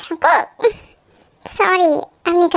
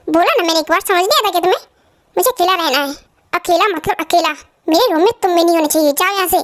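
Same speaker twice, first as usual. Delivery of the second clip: usual speaking voice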